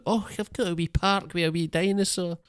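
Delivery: put-on high, lilting Scottish old man; hammed-up Scottish accent, pitched high; wee Scottish man. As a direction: do a little Scottish grandad marvelling. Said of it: "this wee Scottish man going".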